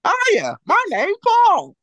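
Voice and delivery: high, slow goofy cartoon voice. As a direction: play a dopey cartoon guy. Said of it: "you got me sounding like a, uh, cartoon character".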